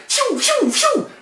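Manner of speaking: high, quick scattering whooshes; whooshing noise. Retold or imitated. Storyteller mimics shoppers scattering, whooshing noise. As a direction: imitate people zipping away, high-pitched.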